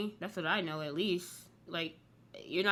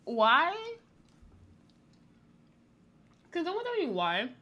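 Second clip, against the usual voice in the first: puzzled; 10 semitones higher, 4 dB louder than usual. Real, not acted. Puzzled outburst.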